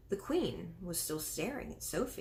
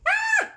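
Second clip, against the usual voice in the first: a very high, loud short shriek; piercing scream; 13 dB louder than usual. A narrator's reading. Maid sees a girl at the window and screams. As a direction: shriek at the sudden sight. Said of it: "The maid screamed".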